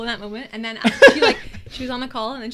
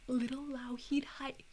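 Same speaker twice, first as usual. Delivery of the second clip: giggly prank whisper; 2 semitones higher, 10 dB quieter, slower than usual. Retold or imitated. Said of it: "should I whisper to the camera".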